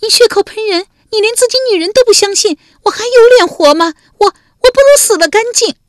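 loud, very high hysterical wailing; wailing cry. A narrator's reading. Wife wailing at her husband, loud and hysterical. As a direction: howl and sob it out like she'd rather die. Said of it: "文姜嚎的一声哭了".

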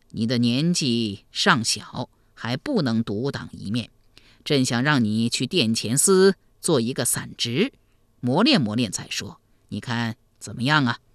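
low, measured elder's voice; deep, unhurried avuncular emperor; kindly emperor uncle. Acted. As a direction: speak as a benevolent old ruler.

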